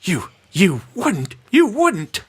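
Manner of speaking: put-on high, cracking old lady voice, pleading; quavering old crone, panicking; frightened old woman. Old woman threatened by assassin.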